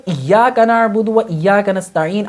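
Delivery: slow recitation, ایاک with the doubled ya held; correct recitation again. Shown as a demonstration. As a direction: say ایاک slowly with the shadda stressed. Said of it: "میں ایک example دیتا ہوں". (ایاک کی تشدید واضح کر کے آہستہ پڑھنا)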